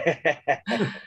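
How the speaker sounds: a quick real laugh at the joke; short laugh. Real, not acted. Host sharing a joke with his co-host, laughing. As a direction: laugh along lightly.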